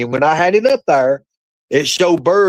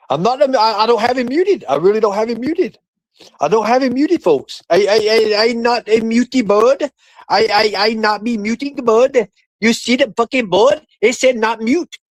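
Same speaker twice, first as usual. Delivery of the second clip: higher put-on broken-English voice; higher goofy character voice, half-joking; mock silly voice; 7 semitones higher than usual. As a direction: play a dim caricature protesting. Mocking broken-English rant about muting.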